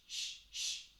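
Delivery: short sharp unvoiced shh pushes; shh breaths from the abs. Shown as a demonstration. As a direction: push out quick shh sounds from the belly. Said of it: "give me shh".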